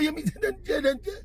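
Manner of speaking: high, laughing through the words; can't believe what he heard, laughing; real laughter breaking into the words; laughing in disbelief. Real, not acted. Laughing at driver's answer.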